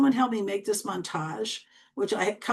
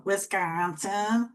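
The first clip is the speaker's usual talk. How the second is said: put-on Wisconsin accent with the middle vowel long drawn out; slow, stretched "Wisconsin" in a thick local Midwestern accent; much slower than usual. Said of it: "By your accent".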